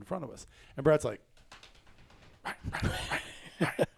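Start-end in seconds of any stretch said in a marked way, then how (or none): 2.4-3.9 hushed, urgent whisper of a name. Retold or imitated. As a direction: whisper so the buck won't hear. Whispering in blind near buck.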